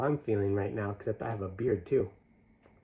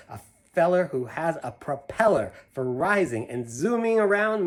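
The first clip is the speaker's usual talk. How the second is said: put-on lively read-aloud to kids; animated picture-book reading; story narration; 3 semitones higher, 6 dB louder than usual. A narrator's reading. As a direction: read the rhyme with a bouncy lilt. Reads Seuss poem to children.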